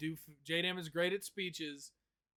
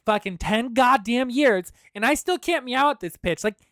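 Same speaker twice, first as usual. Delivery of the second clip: put-on much higher, louder girly voice; girl voice; 6 semitones higher, 13 dB louder than usual. Shown as a demonstration. Complaining about practising girl voice, high loud voice. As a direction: push the voice up high and bright. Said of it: "my fucking girl voice".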